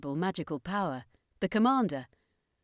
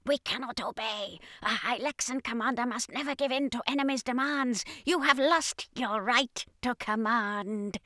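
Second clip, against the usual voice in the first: defiant alien soldier; 5 semitones higher than usual. Acted. Red Hylexan refusing the commander, high alien voice. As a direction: refuse coldly in a strange high voice. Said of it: "the Red Hylexan replied".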